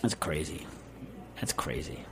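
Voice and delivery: very low, soft mutter sinking to a whisper; hushed disbelief. Real, not acted. Host reacting to his own frap story, hushed disbelief. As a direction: say it under your breath, stunned.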